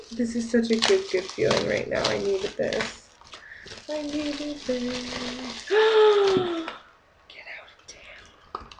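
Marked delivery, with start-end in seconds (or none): 3.9-5.7 much softer and very slow, drawn out; soft heartfelt repeat. Real, not acted. Grateful unboxing, repeated softly. 5.8-6.8 sharp high gasp of delight; excited gasp. Real, not acted. Opening a surprise package. 7.3-8.7 breathless whisper of disbelief; barely voiced, as if you can't believe it; stunned whisper. Real, not acted. Stunned by a gift.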